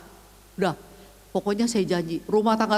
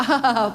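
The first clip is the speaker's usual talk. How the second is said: short laugh while recalling the moment; tertawa. Retold or imitated. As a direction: laugh as you tell it. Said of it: "saya ketawa". (tawa pendek saat bercerita)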